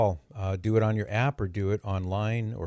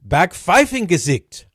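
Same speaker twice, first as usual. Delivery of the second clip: Backpfeifengesicht loud, slow and higher in a hammed-up faux German accent; 8 semitones higher, 8 dB louder, slower than usual. Shown as a demonstration. Host savoring his new word for Netflix, faux German accent. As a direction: relish every syllable of Backpfeifengesicht in a mock German accent. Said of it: "Let me say it again".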